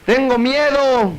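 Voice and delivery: high, drawn-out cry; mock frightened cry. Frightened man crying out in a cave, mock shout. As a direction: call it out slowly, pitched high. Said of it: "Y luego empezó a decir".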